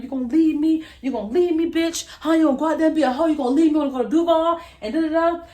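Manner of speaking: retelling a screaming boyfriend, hammed up angry; imitating his angry yelling. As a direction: throw his accusations back loud and fast. Abuser yelling at her in shower.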